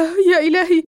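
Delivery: Larry crying as he sees half his body gone. Acted. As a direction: break down in horror.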